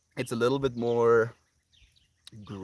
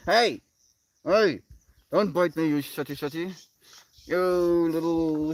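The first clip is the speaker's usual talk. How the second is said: playful scolding; 6 semitones higher, 5 dB louder than usual. Real, not acted. Telling dog off.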